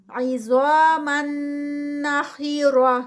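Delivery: slow نَخِرَةً with a heavy خ and ر; Quranic recitation. Shown as a demonstration. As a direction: chant it slowly as a tajweed drill. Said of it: "زا جو ہے وہ heavy ہے".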